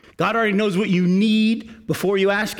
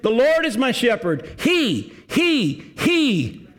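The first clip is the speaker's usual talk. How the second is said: "He" repeated, each higher and drawn out; stress on he; 6 semitones higher, much slower than usual. Shown as a demonstration. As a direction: hammer the word "he" rising each time. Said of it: "how he changed".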